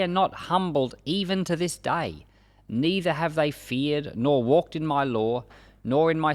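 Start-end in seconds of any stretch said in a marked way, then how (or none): none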